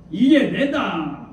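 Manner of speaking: slow, drawn-out Gyeongsang proclamation; Gyeongsang dialect. Retold or imitated. God appearing as a huge wave, declaring in Gyeongsang dialect. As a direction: imitate God announcing himself in Gyeongsang lilt. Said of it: "경상도 말씀으로".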